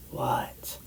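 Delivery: hushed one-word question; whispered reply. Retold or imitated. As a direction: whisper back, curious. Cousin whispers back in story.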